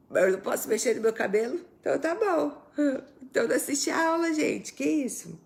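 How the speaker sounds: high, fast, indignant retort; mock indignation. Real, not acted. Answering a viewer who complained about her hair, indignant.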